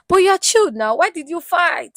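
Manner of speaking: high, loud disbelieving question; mock shock. [name] asking Faith in shock why she fought, high and loud.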